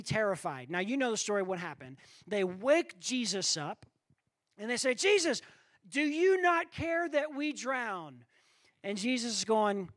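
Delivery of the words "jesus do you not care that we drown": panicked plea. Acted. Frightened disciples wake Jesus.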